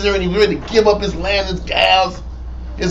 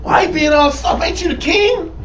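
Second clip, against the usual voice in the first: scolding Jezebel; 6 semitones higher than usual. Retold or imitated. Jezebel scolding soft king.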